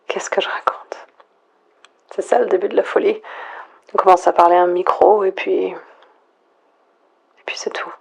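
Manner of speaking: soft, breathy near-whisper into the mic; quiet, intimate monologue alone at night; hushed whisper. Acted. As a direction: whisper as if afraid to break the silence. Lone survivor whispering into mic.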